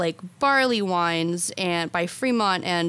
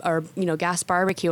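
normal talking voice

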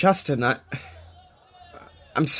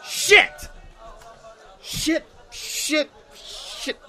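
frustrated curses; 13 semitones higher, much slower than usual. Acted. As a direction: spit out the curses in despair. Dane curses after the call ends.

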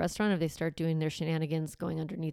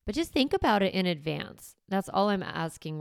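ordinary delivery

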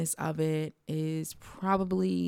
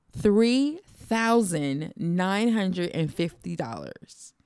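slow disbelief; much slower than usual. Real, not acted. Repeating shocking price slowly.

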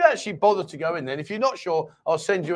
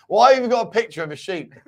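usual speaking voice

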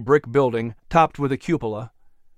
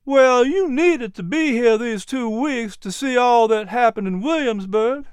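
Miz P's voice; 8 semitones higher than usual. Acted. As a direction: voice a kindly teacher, pitched up.

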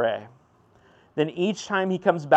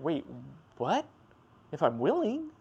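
incredulous surprise; 6 dB quieter than usual. Retold or imitated. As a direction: ask it in gentle disbelief. Jesus surprised by leper.

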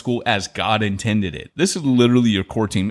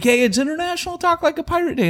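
chirpy radio host; faster than usual. Retold or imitated. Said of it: "They're like".

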